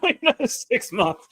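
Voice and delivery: high, giggly repeat; laughing. Real, not acted. Streamer amused at ignoring the game quest, laughing. Laughing at own procrastination joke.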